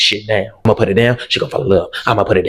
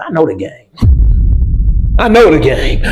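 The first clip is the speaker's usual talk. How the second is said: excited; 8 semitones higher, 4 dB louder, much slower than usual. Retold or imitated. Boasting in the closet.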